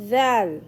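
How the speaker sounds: Zal with the tongue held between the teeth, like English th. Shown as a demonstration. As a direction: bite the tongue lightly and say Zal. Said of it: "Hold your tongue between upper teeth".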